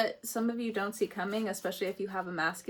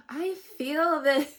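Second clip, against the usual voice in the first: laughing; 6 semitones higher, 7 dB louder, slower than usual. Real, not acted. Laughing reacting to reading.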